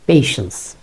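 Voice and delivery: পেশেন্স said as pay-shens; পেশেন্স with an "e" vowel, not "a"; correct patience. Shown as a demonstration. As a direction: say পেশেন্স with a clear "pay" sound. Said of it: "যদি একটু পেশেন্স বলি".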